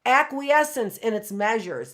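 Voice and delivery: stately reading from a historic speech; formal speech reading. Acted. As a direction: read it like a solemn founding text. Reads Washington's farewell address aloud.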